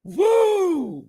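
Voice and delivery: high whooping woo; high cheer. Real, not acted. Streamer cheering over favorite breakfast, whooping. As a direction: let out a gleeful high whoop.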